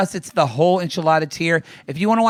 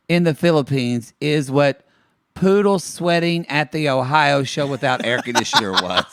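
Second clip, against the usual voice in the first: ordinary delivery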